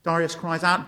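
ordinary talk

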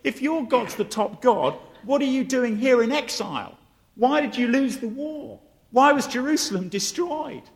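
lightly acted playground mockery; mocking kids. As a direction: taunt him like kids in a street argument. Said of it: "the Babylonian kids laugh at him".

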